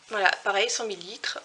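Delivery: ordinary talk, nothing marked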